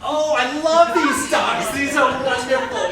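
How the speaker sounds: slow, loud gushing over the dogs; drawn out like someone who adores dogs; gushing delight. Acted.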